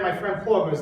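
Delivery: ordinary talk, nothing marked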